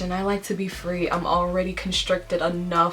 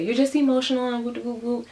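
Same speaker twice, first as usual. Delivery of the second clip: higher, fast, dismissive sing-song mimicry; mocking the critics; 6 semitones higher, faster than usual. Imitating people who dismiss her feelings, higher mocking sing-song. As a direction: toss it off like a scoffing doubter. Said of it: "a lot of people are gonna say".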